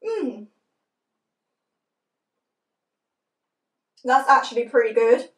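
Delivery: talking through hand over mouth; chewing while talking. Real, not acted. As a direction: mouth full of food. Seen 3: Closed-mouth chewing through words, then hand covers mouth while chewing.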